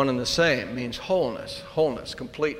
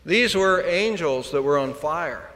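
usual speaking voice

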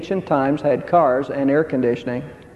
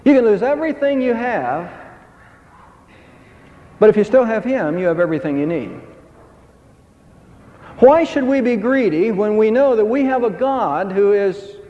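ordinary talk, nothing marked

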